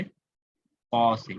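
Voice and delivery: क़ा said very slowly, deep from the back of the throat; teacher modelling the qaf in क़ा से; careful qaf. Shown as a demonstration. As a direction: stretch out क़ा से to show the qaf sound. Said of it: "ये ता जो है ये बदल गया किससे".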